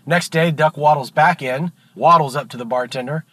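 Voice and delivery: normal talking voice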